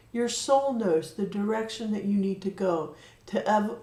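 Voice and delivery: usual speaking voice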